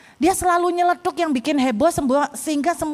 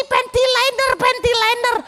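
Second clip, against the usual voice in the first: high, excited heckling; high mock classroom shout-out; imitating another pupil; 11 semitones higher than usual. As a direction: chime in like a cheeky kid. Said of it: "Sing lain".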